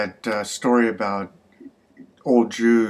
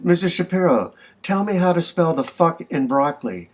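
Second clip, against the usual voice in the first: higher-pitched, mildly insistent clerk; leading question; 7 semitones higher than usual. Acted. Clerk setting up Missus Shapiro with a spelling question, mildly insistent. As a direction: press the question home up high.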